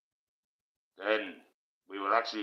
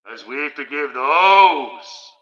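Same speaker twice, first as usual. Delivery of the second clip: very loud, slow, higher church intonation; hammed-up ethereal prayer; 5 semitones higher, 13 dB louder, much slower than usual. Streamer hamming up the Lord's Prayer for chat, loud slow ethereal intoning. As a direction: intone it like a grand cathedral echo. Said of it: "Let's ham it right up".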